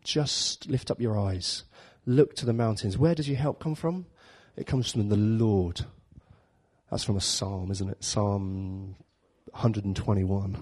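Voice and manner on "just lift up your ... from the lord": slow, soft, calming reassurance; gentle divine voice. Acted. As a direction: soothe a worried believer. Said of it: "God's like".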